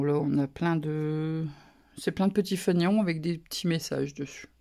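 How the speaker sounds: usual speaking voice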